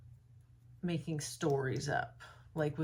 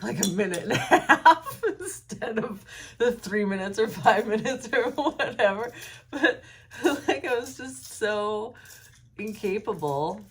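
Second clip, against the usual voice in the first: laughing out loud, louder, at herself; 5 semitones higher, 10 dB louder than usual. Real, not acted. Recalling rushing a school report, she laughs loudly. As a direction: laugh through the memory of rushing. Laughing at her own speed talking.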